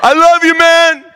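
very high, loud comic shout; mock manly voice. Player reassuring angry teammate, shouted mock-manly declaration. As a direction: belt it out with exaggerated bro bravado. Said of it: "I said it with a real manly voice".

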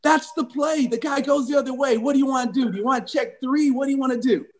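high-pitched rush of anxious questions; urgent pleading. Retold or imitated. Player on the sideline begging coach for the call, urgent. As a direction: act out a player pressing for an answer.

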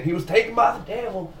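high, preachy devil pronouncement; mildly hammed-up preacher; mock preacher voice. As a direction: intone it like a fire-and-brimstone preacher. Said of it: "Did he say it like that?".

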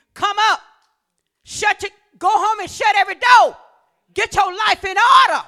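high, shouted commands; forceful preaching. Acted. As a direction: call the people to order at full voice.

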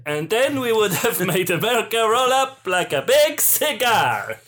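hammed-up, bungled Italian accent; shaky Italian accent. Villain lamenting a foiled plan, botched Italian accent. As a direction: boast in a bad Italian accent. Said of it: "I can't do an Italian accent".

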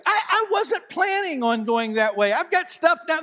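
high, whining complaint; whiny protest. Pretend. Preacher protesting God's detour, high whiny complaint. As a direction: plead like a put-out child. Arguing with God.

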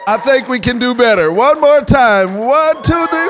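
high, building call to the crowd; rallying call. Real, not acted. Preacher urging the congregation to give a big shout, rallying call. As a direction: rouse them, climbing toward the count.

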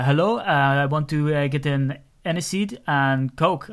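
ordinary delivery

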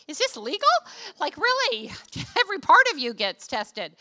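high, loud mock disbelief; incredulous. Traveler questioning a German airport pat-down, high incredulous protest. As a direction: play up shocked protest, pitch leaping.

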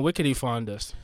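ordinary talk

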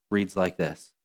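normal talking voice

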